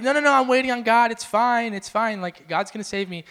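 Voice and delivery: imitated stubborn man declining help; insistent refusal. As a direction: wave off rescue, totally sure. Drowning man waves off rescuers.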